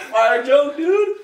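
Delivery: sarcastic. Real, not acted. Sarcastic praise of non-joke.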